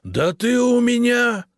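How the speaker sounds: very high, loud, drawn-out shout; spluttering, furious bellow; outraged roar. A narrator's reading. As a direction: explode with rage. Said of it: "взревел Прудников".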